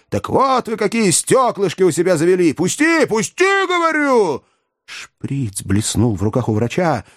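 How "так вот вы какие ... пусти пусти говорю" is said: high, frantic struggling shout; screaming. A narrator's reading. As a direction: yell while thrashing. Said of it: "кричал".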